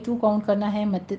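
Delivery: ordinary delivery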